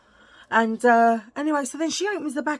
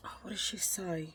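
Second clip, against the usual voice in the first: whispered aside; 3 semitones lower, 12 dB quieter than usual. Real, not acted. Aside while trying to remember.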